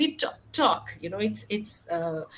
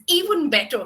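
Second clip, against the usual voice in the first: higher, louder happy exclamation; exclaimed; 4 semitones higher, 7 dB louder than usual. A narrator's reading. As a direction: burst out with joy. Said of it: "he exclaimed".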